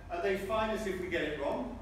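ordinary delivery

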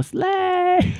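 slay squealed way up high. Real, not acted. Gamer squealing slay after crafting a bed. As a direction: shriek it like a victory.

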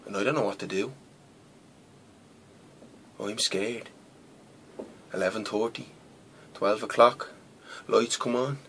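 quietly tense, with long pauses; hushed dread. Acted. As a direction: drop your voice, waiting for what's coming. Retelling waiting in fear for guards.

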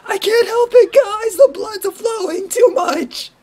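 very high, slow squealing plea; giddy fan excuse. Retold or imitated. Fan excusing himself to his teasing friends, very high and slow. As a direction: whine it out, helplessly overexcited.

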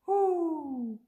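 high owl hoot. Pretend. Parent reading the owl page, high hoots. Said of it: "He can sound like an owl".

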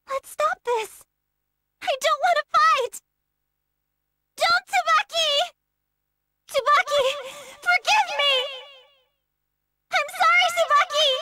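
high, crying plea to her friend; anguished pleading. Acted. Girl forced to fight her best friend Tsubaki, tearful cries. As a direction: beg through tears as you strike her.

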